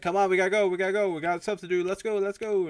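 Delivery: loud, high, urgent rush; frantic hurrying. Retold or imitated. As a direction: rush someone out the door, loudly. Acting frantic packing and hurrying her.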